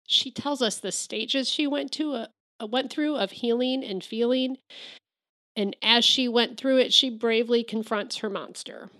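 tearful voice rising high; choked up, holding back tears; crying. Real, not acted. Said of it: "I'm sorry, I'm crying".

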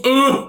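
mock pig-army call grunt. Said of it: "that's the call".

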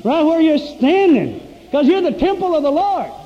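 high, loud, fired-up revival shout; impassioned pulpit cry, punching the phrase ends; full-force preaching. Real, not acted. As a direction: preach it at the top of your voice.

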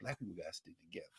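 very soft, fast, low; hushed quote. Retold or imitated. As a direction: let it fall away almost to nothing. Repeats the quote, wondering.

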